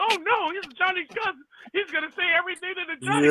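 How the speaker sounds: high, lightly voiced mocking imitation; mocking the hosts. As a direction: tease them in a high put-on voice. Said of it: "But they like".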